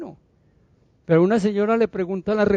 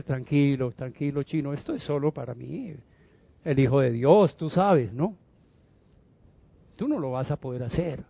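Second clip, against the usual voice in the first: low, soft, offhand discouragement; mock condescending murmur; casual brush-off; 4 semitones lower, 6 dB quieter than usual. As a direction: quietly talk him out of it. Imagined dismissive reply from the Lord.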